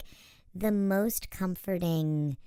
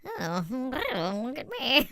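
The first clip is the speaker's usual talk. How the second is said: high, slow, preening voice; vain Skeksis; 5 semitones higher, much slower than usual. Retold or imitated. Imagining Skeksis strutting at a runway show. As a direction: show off like a catwalk monster. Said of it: "the Skeksis were like".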